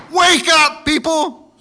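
loud, high call to wake; preaching at full force; rousing shout. Acted. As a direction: shake the dead church awake. Preacher voicing Jesus rebuking church.

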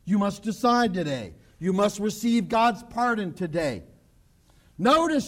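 usual speaking voice

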